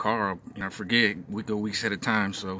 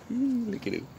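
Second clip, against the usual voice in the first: cooing; 12 semitones higher, 3 dB quieter, slower than usual. Real, not acted. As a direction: coo it up high like at a puppy. Cooing at the cute bird.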